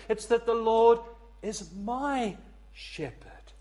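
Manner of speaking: MY drawn out after a pause; stress on MY. Shown as a demonstration. Contrasts the, a and my shepherd.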